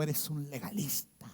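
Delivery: retold jab, whispered. Preacher recalls a rival's whispered jab, whispering. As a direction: hiss it under your breath.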